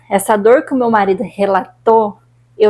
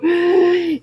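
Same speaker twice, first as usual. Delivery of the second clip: gasp; 6 semitones higher than usual. Retold or imitated. Retelling gasping for air.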